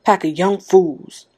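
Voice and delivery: slow, stout dismissal. A narrator's reading. Old man scorning the young, stout and slow. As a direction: draw it out with firm scorn. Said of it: "Old Man Warner said stoutly".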